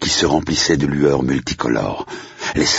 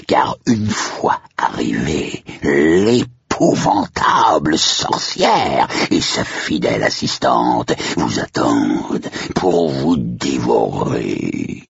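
slow, drawn-out horror host; theatrical spooky warning, very slow; menacing hellish majordomo; much slower than usual. Acted. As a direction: draw out each threat like a ghoulish butler. Plays the ghoulish majordomo's warning.

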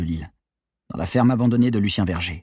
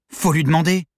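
strained effort; 8 semitones higher, 4 dB louder than usual. Acted. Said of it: "parvins-je à articuler".

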